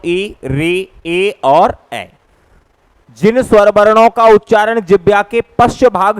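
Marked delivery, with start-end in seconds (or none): 0.0-2.1 री, ए और ए stretched long; very slow listing. Shown as a demonstration. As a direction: count the vowels slowly one by one. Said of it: "ये संख्यात्मक कितने होते हैं". (री, ए और ए बहुत धीरे गिनाना)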